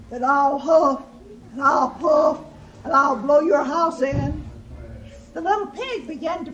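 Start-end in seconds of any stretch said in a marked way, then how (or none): 0.1-4.4 loud, punched-out wolf threat; threatening wolf. A narrator's reading. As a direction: boom out each threat with a pause between.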